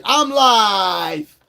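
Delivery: long, drawn-out holler; exasperated sing-out to a friend; calling out. Pretend. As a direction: holler it as a stretched-out announcement. Calling out at a party invite.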